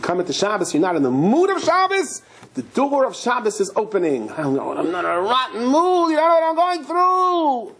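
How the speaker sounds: high, whiny protest; a put-upon man refusing to be hurried; grumbling congregant. Acted. Man complaining to Shabbos, high and whiny.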